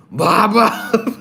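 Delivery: high, strained put-on voice for Baba; high character impression. Shown as a demonstration. Streamer trying a Baba impression for chat, high and strained. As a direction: push the voice up and pinch it. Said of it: "Let me see if I can do an impression".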